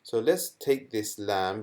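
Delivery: ordinary talk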